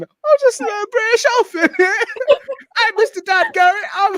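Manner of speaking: put-on high, loud British boy voice; British orphan voice. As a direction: pitch it way up like a little British orphan. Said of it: "he's a little British orphan".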